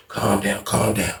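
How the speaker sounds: very low, hushed calm-down; creepy soothing. Retold or imitated. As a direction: fade into a soft, low lull while massaging shoulders. Acts Pop soothing him.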